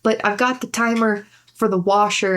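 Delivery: usual speaking voice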